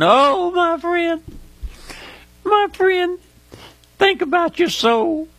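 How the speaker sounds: very high, anguished appeal; emotional pleading. Real, not acted. Preacher begging a friend to think of eternity, emotional pleading. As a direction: plead from the heart, voice soaring high. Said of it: "I get emotional sometimes thinking about".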